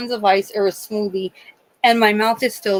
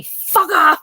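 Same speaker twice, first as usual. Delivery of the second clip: higher, louder burst of cursing; mock cursing yell; 8 semitones higher, 5 dB louder than usual. Patient quoting her roommate, higher and louder. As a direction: belt the curse out.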